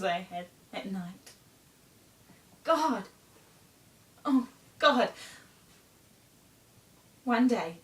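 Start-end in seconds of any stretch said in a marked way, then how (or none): 2.6-5.1 loud, high theatrical cry; anguished exclamation. Acted. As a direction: cry out to God over frightful memories. Monologue, recalling frightful dreams.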